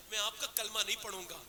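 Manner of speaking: normal talking voice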